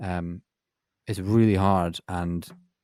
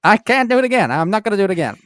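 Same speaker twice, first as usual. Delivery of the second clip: pitched way up, playfully refusing; high-pitched protest; 11 semitones higher, 6 dB louder than usual. Real, not acted. Guest asked to repeat his impression refuses, high-pitched playful protest.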